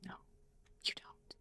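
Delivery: a breathy whispered you don't; whispered retort. Real, not acted. Vlogger to judgers, a defiant whisper. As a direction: drop to a whisper for the last word.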